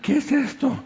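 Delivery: high, hushed mock alarm; alarma fingida. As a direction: ask it high, as if sniffing danger. Gacela alarmada huele al león. (susto fingido, en voz aguda)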